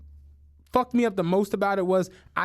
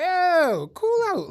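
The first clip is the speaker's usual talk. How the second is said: urgent calming; 8 semitones higher, 3 dB louder, slower than usual. Retold or imitated. Calming friend in story.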